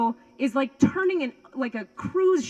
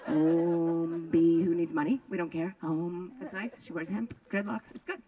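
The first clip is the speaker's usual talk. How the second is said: lightly voiced lazy hippie; a hint of a mellow stoner chant; Zen hippie; 6 semitones lower, 5 dB quieter than usual. Chilled-out hippie inner voice shrugging off rent, mellow. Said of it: "super chilled out Zen hippie spiritualist".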